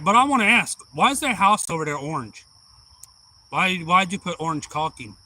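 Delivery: loud puzzled boss. Retold or imitated. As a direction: demand to know loudly, pitch up. Boss questions the orange caulking.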